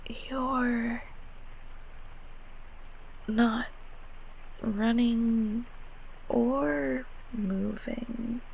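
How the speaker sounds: halting, with long pauses; slow, cautious and confused; hesitant puzzlement. Acted. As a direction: feel out each word slowly and softly.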